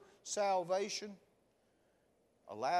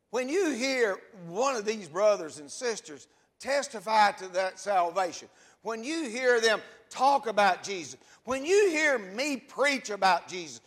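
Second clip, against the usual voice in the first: loud, rising sermon build to the congregation; preaching at full force; 5 semitones higher, 10 dB louder than usual. Acted. Pastor challenging the congregation to respond, full-force preaching. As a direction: pastor pressing the church with rhetorical questions, preaching loud.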